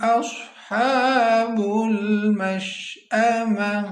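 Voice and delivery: slow, high melodic tajweed chant; Quran recitation. Shown as a demonstration. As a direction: recite the verse as a drawn-out melody.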